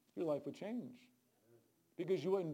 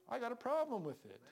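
usual speaking voice

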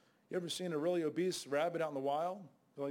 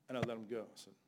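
normal talking voice

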